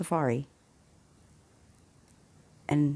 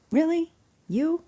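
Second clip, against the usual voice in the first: scoffing disbelief; 13 semitones higher, 4 dB louder than usual. Retold or imitated. Wife scoffs at the pilot.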